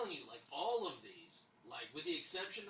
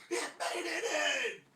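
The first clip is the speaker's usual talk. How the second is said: high, loud, drawn-out squeal; hammed-up cry of delight; overjoyed; 13 semitones higher, 10 dB louder, slower than usual. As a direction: shriek it slowly in triumph. Retold joy, "I was so happy.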